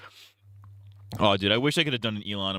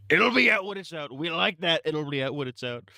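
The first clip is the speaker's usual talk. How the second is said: raised, put-on presidential voice; Trump impression; 6 semitones higher than usual. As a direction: do a mock Trump. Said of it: "doing a Trump impression".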